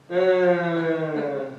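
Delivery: put-on a kid faking a cry for attention; fake crying. Kid fakes crying to get his brother in trouble, mock wailing. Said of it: "No tears were coming out of my eyes".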